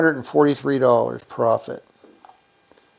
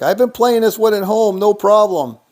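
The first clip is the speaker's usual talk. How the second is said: higher-pitched, breezy imitation of a viewer; breezy boast; 6 semitones higher, 4 dB louder than usual. Streamer quoting a viewer's claim of easy wins, breezy mock boast. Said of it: "Red Eye was like".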